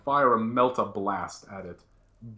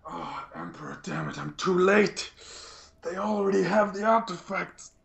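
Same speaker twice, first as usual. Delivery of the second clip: building, acted despair; anguished despair; 9 semitones higher than usual. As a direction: lament that all is lost, pitch climbing. Inquisitor despairs at being too late.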